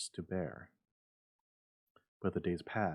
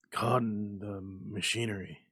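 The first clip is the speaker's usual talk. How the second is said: dazed; 4 dB louder than usual. A narrator's reading. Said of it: "in a dazed fashion".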